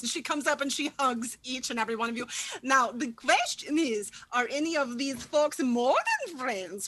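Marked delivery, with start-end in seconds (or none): none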